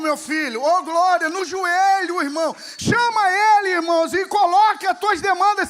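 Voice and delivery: loud, fervent preacher's call; preaching at full force. Acted. Preacher calls church to pray. (pregação inflamada, em voz alta)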